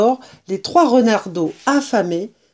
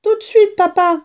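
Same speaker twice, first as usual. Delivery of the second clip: put-on much higher, child cub voices; eager cubs; 10 semitones higher, 4 dB louder than usual. A narrator's reading. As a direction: answer father brightly as little cubs. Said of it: "répondirent-ils".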